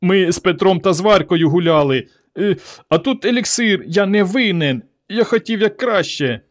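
high, whiny excuse; sniveling, high-pitched pleading; boy whimpering. A narrator's reading. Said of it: "рюмсав Іван".

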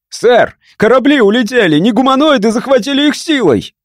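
высокий испуганный крик; кричать в панике, задыхаясь от страха; перепуганный крик. Acted. (испуганный гонец кричит командиру о захвате кораблей)

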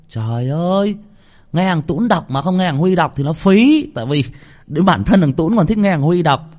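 loud, high, gushing over his reading; excited praise. Real, not acted. Host gushing to listeners about Huy's reading, loud and high. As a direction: rave about how good he sounds.

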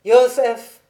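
appel très fort, plus aigu; héler gaiement son ami; interpellation enjouée. A narrator's reading. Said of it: "partit d'un éclat de rire et dit". (lancer le nom avec entrain)

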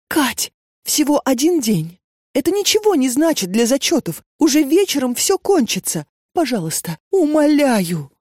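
high, pleading, near tears; desperate begging. A narrator's reading. Kneeling Lena begs Katya to go instead, high and tearful. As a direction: beg her friend, voice rising in despair.

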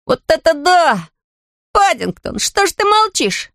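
put-on high, excited boy's shout; a thrilled boy calling out, slowly at first; delighted yell. A narrator's reading. Jonathan thrilled by the Guy he found in the shed. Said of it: "восторженный вопль Джонатана".

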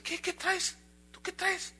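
dismissive challenge. Acted. Wolf comes back to the shouting deer, challenging him. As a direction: toss it back, small and high.

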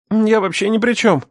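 high, frightened outburst; blurted denial. A narrator's reading. Young captive swears he is uninvolved, blurting. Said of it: "выпалил он".

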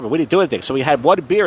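normal talking voice

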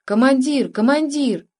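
understated urgent hail; sudden call. A narrator's reading. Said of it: "И вдруг крик".

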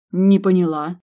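a sharp, irritated grumble; irritated mutter. A narrator's reading. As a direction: snap it out, annoyed and baffled. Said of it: "раздражённо пробормотала Милдред".

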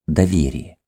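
plain reading voice

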